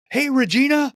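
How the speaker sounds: bright high call of a name; chirpy text greeting read aloud; cheery greeting. Retold or imitated. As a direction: sing out the name like a happy hello. Retells typing a cheery text.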